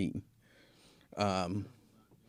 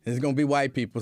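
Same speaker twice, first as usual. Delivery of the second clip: usual speaking voice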